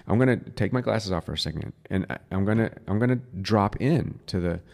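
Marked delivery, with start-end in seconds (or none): none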